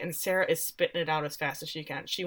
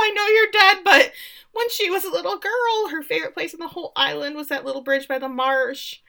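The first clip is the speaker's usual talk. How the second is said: exaggerated high-pitched lament; mock storyteller; 10 semitones higher, 5 dB louder than usual. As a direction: imitate someone launching into a tearful reminiscence. Mock Millie launching into a story.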